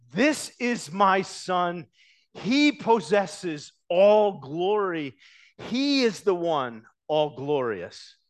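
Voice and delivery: God's voice booming over the disciples; slow loud proclamation. Acted. Preacher voicing God to the disciples, slow loud proclamation. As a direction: proclaim it slowly and loudly, each word weighted.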